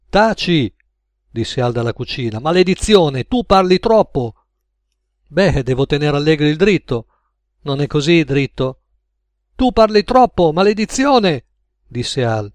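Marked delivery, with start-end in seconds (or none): 0.1-0.7 sharp, higher command to shut up; irritated order. Acted. Al silencing Max from the kitchen, irritated. Al silences his talkative partner. 2.4-4.3 high, raised scolding with a curse; annoyed rebuke. Acted. Al to Max for giving away too much, annoyed. 9.5-11.4 loud, high exasperated scolding; angry rebuke. Acted. Al to Max who keeps talking, exasperated. Al repeats his rebuke, more heated.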